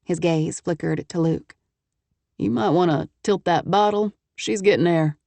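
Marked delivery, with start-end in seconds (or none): 2.4-5.2 lightly voiced Texan businessman. A narrator's reading. Travis sending his associates off, lightly voiced Texan. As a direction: play the self-assured Texan host, lightly.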